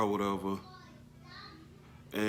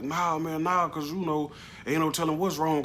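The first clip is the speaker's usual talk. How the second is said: urgent refusal; 7 semitones higher, 4 dB louder than usual. Retold or imitated. Arguing with bystanders at crash.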